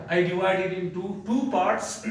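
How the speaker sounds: ordinary talk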